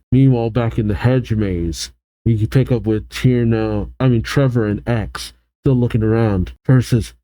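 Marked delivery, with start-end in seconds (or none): none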